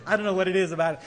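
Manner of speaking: ordinary talk, nothing marked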